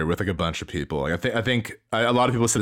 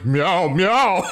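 high, louder mock meow; cat meowing; 8 semitones higher, 4 dB louder than usual. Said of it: "and then going".

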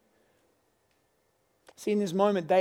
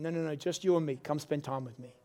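a soft, lower inner voice; gently, like a quiet nudge inside; small voice; 5 semitones lower, 5 dB quieter than usual. Retold or imitated. The Holy Spirit inviting him away, a soft lower voice. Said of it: "And I hear it again".

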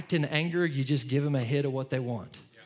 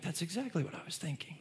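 much softer, hushed amazement; amazed kid; 10 dB quieter than usual. Retold or imitated. Kid amazed that dad read his mind, hushed. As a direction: breathe it out like a surprised child. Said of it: "my kids are like".

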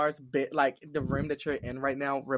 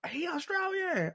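mock realization; 5 dB quieter than usual. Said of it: "I was like".

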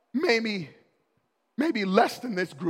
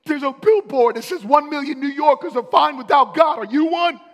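excited; 10 semitones higher, 13 dB louder than usual. Retold or imitated. Students retelling billboard.

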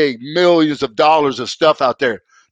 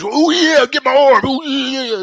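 much higher Randy Savage croon; imitating Macho Man; 8 semitones higher than usual. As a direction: ham up Randy's catchphrase. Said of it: "they were all talking like this".

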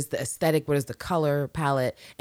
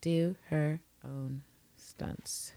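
soft, slow muttering to herself while typing; muttering while searching; 7 dB quieter, much slower than usual. Real, not acted. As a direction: mumble it as you type the search. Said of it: "trying to do a quick Google".

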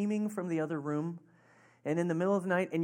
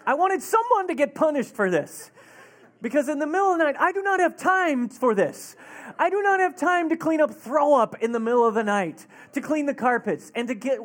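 loud, raised-pitch exasperated rant; mad parent's rant; 6 semitones higher, 6 dB louder than usual. Retold or imitated. Father facing a midnight cleanup, retold angry rant. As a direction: hammer out the complaint in retold outrage. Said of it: "I was so mad".

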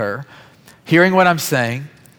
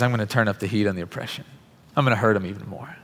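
lower, softer, cold menace; Pharaoh defiant; 4 semitones lower, 7 dB quieter than usual. Retold or imitated. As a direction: murmur the threat with quiet contempt. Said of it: "he says".

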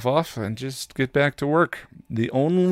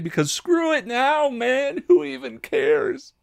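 much higher, whiny who-cares voice; giving-up slob voice. Pretend. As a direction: squeal it like you've given up on everything. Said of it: "Dignity, [name]".

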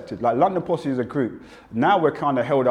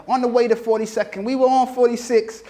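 high-pitched American rap twang; put-on American MC accent; 10 semitones higher than usual. Shown as a demonstration. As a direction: recite the lyric with an American twang. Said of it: "with a very American twang".

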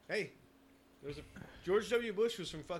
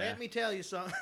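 mock southern drawl; 7 semitones higher than usual. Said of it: "He was down there for".

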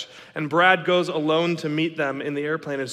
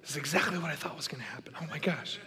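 hushed, breathless panic, much softer; freaked-out whisper; 12 dB quieter than usual. Retold or imitated. Newcomers panicking under their breath at the bad news, whispering. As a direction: whisper it like you just heard terrible news. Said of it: "let the other guys freak out".

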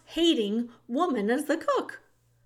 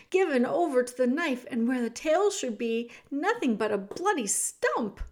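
plain reading voice

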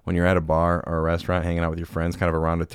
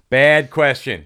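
mock scolding; 7 semitones higher, 8 dB louder, much slower than usual. Teasing guest about bad question.

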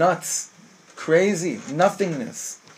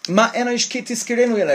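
protesting angels; 6 semitones higher, faster than usual. Acted. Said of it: "Torah saying".

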